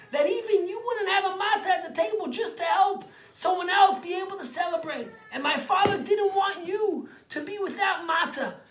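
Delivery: son's message climbing to a high, loud cry; emotional shout. Acted. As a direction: shout it out with tears of joy. Son reveals father's kind plan.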